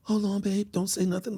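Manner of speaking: high, soft hushing voice; high secretive mock woman; imitating woman friend. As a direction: shush him in her high girlfriend voice. Voices woman hushing him before a call.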